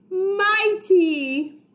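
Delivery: high, loud triumphant shout; heroic cry. Acted. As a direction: burst out like a superhero. Hero cry in story.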